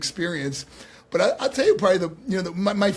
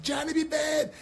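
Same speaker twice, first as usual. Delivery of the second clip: high, shouted name of an excited fan; retold high shout of the nephew spotting his hero; 12 semitones higher than usual. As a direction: call the name out like a starstruck kid. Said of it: "He's like".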